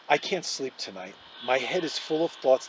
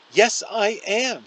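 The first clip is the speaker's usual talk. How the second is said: slow, louder sobbed protest; mild sob pulled out of the words; sobbing; 8 semitones higher, 9 dB louder, slower than usual. A narrator's reading. Olivia insists to Justin she is awful, sobbing. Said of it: "she sobs".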